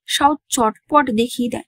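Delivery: plain reading voice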